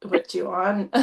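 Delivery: a gruff Scottish greeting, toned down; Scottish accent. Retold or imitated. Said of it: "Whereas in Scotland, it's like".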